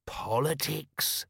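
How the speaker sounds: slow, impressed parroting; feigned understanding. Acted. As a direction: echo it as if it explains everything. Nobby echoes, pretending to understand.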